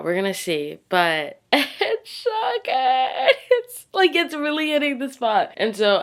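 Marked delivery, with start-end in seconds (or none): 1.5-5.5 high, squealing rave with a laugh; gushing. Real, not acted. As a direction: squeal and stretch it out in delight. Raving about the book.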